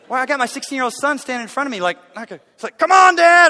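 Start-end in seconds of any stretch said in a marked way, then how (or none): 2.8-3.5 high shouted come on; loud urging. Retold or imitated. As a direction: yell him through the last rep. Son pushing dad.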